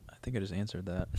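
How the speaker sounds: dry whispered aside; deadpan, whispered under breath. Real, not acted. Dry aside after long question.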